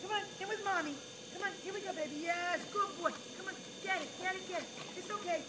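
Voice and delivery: ordinary talk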